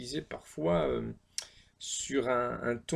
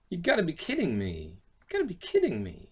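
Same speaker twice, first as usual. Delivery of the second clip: exasperated disbelief; 4 semitones higher than usual. Shown as a demonstration. Teacher demos exasperated English phrase.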